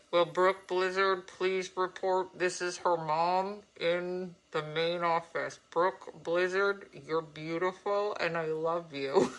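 slow PA announcement voice; over the intercom. Pretend. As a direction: announce it over the school speakers. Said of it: "get on the thing of it".

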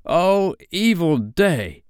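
high, drawn-out wail of dismay; slow, anguished pirate cry; cried out. A narrator's reading. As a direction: lament it theatrically. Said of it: "cried Starkey".

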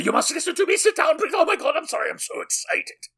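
very high, loud panicked shriek; hammed-up hysterical begging; frantic prisoner. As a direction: plead wildly in a squealing falsetto. Acting the frantic prisoner to priest.

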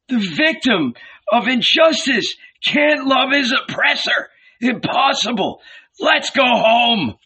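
high, loud angry shouting. A narrator's reading. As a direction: shout him down in anger. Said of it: "growing angry".

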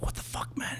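very soft, voiceless whisper. Retold or imitated. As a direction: retell it as a secret hissed aside. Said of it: "I'm like, whispering".

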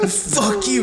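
high-pitched imitation of a defiant boy; mock defiance. Boy insulting the principal again, mock defiance. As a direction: throw the insult back high, half laughing.

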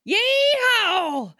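loud high yeehaw holler; cowboy yeehaw. Acted. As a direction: whoop it like a cowboy. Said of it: "whole cowboy themed intro".

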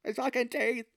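mock Irish accent fighting a mouthful of teeth; struggling Irish accent. Actor excusing bad accent to Doc, failing Irish accent. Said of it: "you're supposed to have an Irish accent".

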